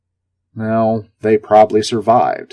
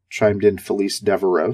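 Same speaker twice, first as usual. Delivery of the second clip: usual speaking voice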